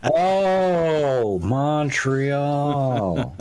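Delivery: Montreal stretched out long and high; exaggerated pronunciation. Playing along with the joke correction, Montreal hammed up long and high. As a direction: draw out Montreal on a soaring, mock-grand high note. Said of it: "I believe it's pronounced Montreal".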